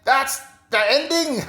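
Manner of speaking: high-pitched playful imitation of her voice; high mimicking voice. Imitating Camilla's line at the end of her video, high and light. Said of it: "what you say on there".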